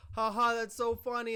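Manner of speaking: high, loud mock "ha ha"; sarcastic laughter. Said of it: "he starts sarcastically laughing".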